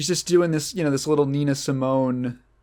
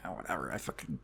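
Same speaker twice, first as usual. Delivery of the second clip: much lower and much softer, a mumbled brush-off; embarrassed mumble; 10 semitones lower, 15 dB quieter, faster than usual. Retold or imitated. Celine shrugging off Jesse's clapping, low embarrassed mumble. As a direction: swallow the words in shy embarrassment.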